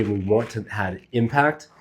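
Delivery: ordinary delivery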